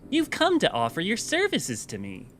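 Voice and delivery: put-on high-pitched Valley Girl accent. Streamer trying out game dialogue, Valley Girl. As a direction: play a Valley Girl with uptalk. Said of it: "Valley Girl".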